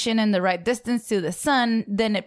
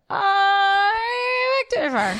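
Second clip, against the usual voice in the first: whiny voice; 10 semitones higher, much slower than usual. Pretend. Pretend computer bit.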